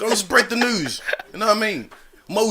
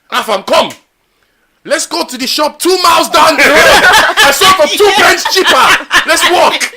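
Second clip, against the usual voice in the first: higher, louder, laughing mock mum; mum's voice; 8 semitones higher, 8 dB louder than usual. Mum urging her son to walk for cheaper onions, laughing mock voice. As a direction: ham up a bargain-hunting mum through laughter.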